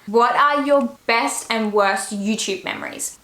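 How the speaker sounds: ordinary delivery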